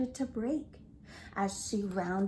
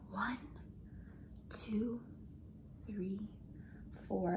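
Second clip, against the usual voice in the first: calming count; 4 semitones lower, 10 dB quieter than usual. Acted. Counting breaths, fading.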